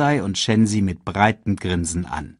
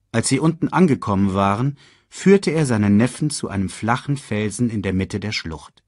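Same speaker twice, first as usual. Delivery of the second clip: ordinary delivery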